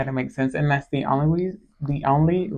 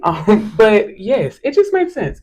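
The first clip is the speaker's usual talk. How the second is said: laughing; 6 semitones higher, 6 dB louder, slower than usual. Real, not acted. Laughing restart after joke.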